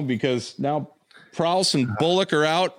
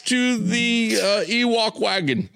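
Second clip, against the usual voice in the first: mock British accent; 10 semitones higher than usual. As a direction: proclaim it in a high fake English accent. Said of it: "Like Darth Mark would be out".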